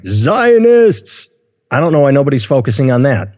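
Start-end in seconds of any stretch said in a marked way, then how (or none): 0.0-1.3 high, drawn-out mock cry of blame; hammed-up high-pitched accusation; mock outburst. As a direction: wail it out in exaggerated outrage. Mock blaming over stubbed toe.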